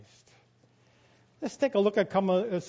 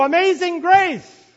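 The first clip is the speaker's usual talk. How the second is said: higher, shouted hymn title; loud shout; 10 semitones higher, 12 dB louder than usual. Retold or imitated. Song leader shouting the hymn title, loud. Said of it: "he says in a loud voice".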